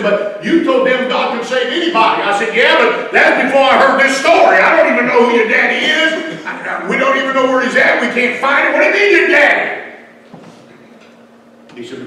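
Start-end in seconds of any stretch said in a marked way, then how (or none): 0.0-10.0 higher and much louder, rushing and cornered; loud rising protest, half panicked; flustered outburst. Retold or imitated. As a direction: blurt it out, caught off guard. Flustered exchange, preacher cornered.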